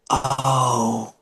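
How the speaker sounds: soft, deflated little oh; stunned oh. Retold or imitated. Gary hearing veggie burger isn't vegan, soft stunned oh. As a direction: let the realization land quietly. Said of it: "That's a quote".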